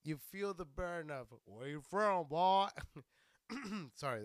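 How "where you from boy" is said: lightly mocked challenge, louder on BOY; a first weak stab at a menacing questioner; half-hearted hostile questioner. Shown as a demonstration. Comedian imitating someone sizing up a stranger, lightly mocked challenge. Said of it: "that didn't give it justice".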